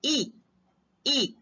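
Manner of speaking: "ई" said alone twice, with a pause between; teacher's isolated, repeated palatal "ई"; palatal vowel demo. Shown as a demonstration. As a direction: demonstrate "ई" as a long ee made at the palate. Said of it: "तालु होता है".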